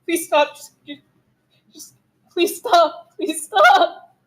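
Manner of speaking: high-pitched, tearful pleading that mounts; frightened, sobbing plea in a high voice; crying and begging. Acted. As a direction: beg through panicked tears, breaking between repeats. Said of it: "crying and begging for him to stop".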